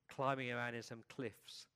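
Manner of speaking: ordinary delivery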